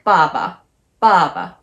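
爸爸 with a strong falling first syllable, light second; down then neutral. Shown as a demonstration. Teacher showing doubled words, down then neutral. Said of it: "like ba is down".

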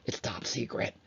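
breathy mock-secret whisper; playful whisper. Teacher telling students the rounding is top secret, playful whisper. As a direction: whisper it like a spy sharing secrets. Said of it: "I don't know why I'm whispering".

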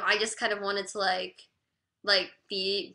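usual speaking voice

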